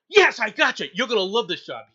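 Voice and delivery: loud, high gleeful gotcha; triumphant. Retold or imitated. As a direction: pounce on him with glee. Recruiter grabs monitor, triumphant.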